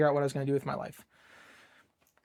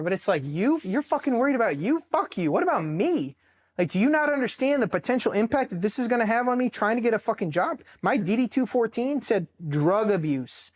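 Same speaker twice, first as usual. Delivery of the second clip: bitter, rising rant at his ex-wife; angry rant at his ex; 6 semitones higher than usual. Retold or imitated. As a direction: vent at her as if she stood there. Ranting at ex-wife retold.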